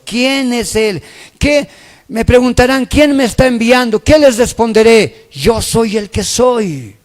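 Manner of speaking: loud, insistent preaching; full-force preaching. Acted. Preacher urging the congregation to know God, loud and forceful. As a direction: preach it out to the whole room, loud and driving.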